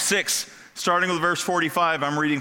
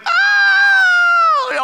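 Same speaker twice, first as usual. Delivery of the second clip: loud, high frightened "Ah!" scream; terrified scream; 11 dB louder than usual. Retold or imitated. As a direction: scream like terrified fishermen seeing a ghost. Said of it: "oftentimes I'll go, They went".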